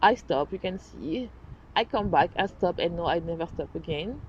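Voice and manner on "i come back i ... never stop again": ordinary talk, nothing marked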